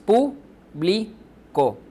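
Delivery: very slow pu-bli-co, a pause after each syllable. Shown as a demonstration. Teacher counting out three syllables, slow and separated. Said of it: "Es una palabra de tres sílabas".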